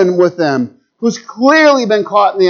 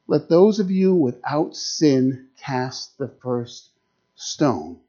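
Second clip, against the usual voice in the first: quiet; 7 semitones lower, 7 dB quieter, slower than usual. Retold or imitated. Jesus answers the mob quietly.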